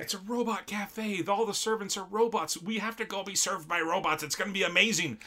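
a boss talks up the robot cafe to his employee; excited manager. Retold or imitated. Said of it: "he's like".

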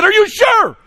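very high, loud challenge; shouting back. Retold or imitated. Speaker answering the ticked student, shouted challenge. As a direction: yell it straight back at him.

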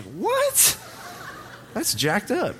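"What?" jumps way up, then laughs it off; shocked outrage. Retold or imitated. Student hearing the teachers planned it, shocked and laughing. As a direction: yelp in disbelief, then a laughing complaint.

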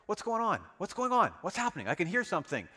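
high, loud, urgent voice of a blind beggar; anxious calling out. Acted. Blind beggar calls out to the passing crowd, anxious and loud. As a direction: call out anxiously, straining to hear. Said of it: "you can imagine sitting there".

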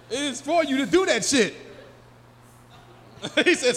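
usual speaking voice

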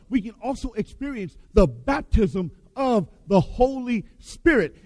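ordinary talk, nothing marked

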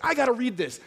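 retold agitation. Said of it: "I was so angry".

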